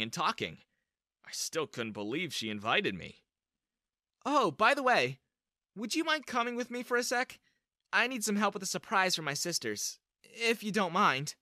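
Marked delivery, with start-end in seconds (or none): 4.2-11.4 put-on higher-pitched girl character, casual; lightly feminine, raised-pitch request; girl asking a favor. A narrator's reading. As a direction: voice the crush, lifted high and inviting.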